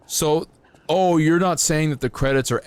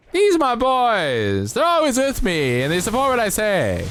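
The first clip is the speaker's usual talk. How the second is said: hammed-up proud voice; 12 semitones higher, 3 dB louder than usual. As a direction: show off your boys in a silly high voice. Showing off his boys.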